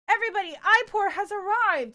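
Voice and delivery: high, loud fanfare for a newcomer; excited announcement. Real, not acted. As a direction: announce it to the whole room. Announcing a viewer's arrival.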